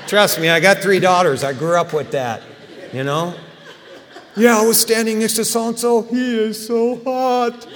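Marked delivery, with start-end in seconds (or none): 4.3-7.7 put-on higher, laughing teen girl swooning; gushing teen daughter. Daughters gushing about a boy.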